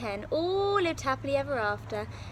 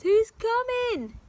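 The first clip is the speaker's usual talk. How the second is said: alarmed high voice; 11 semitones higher, 8 dB louder, slower than usual. Acted. Giantess frightened.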